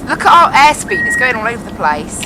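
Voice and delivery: ordinary talk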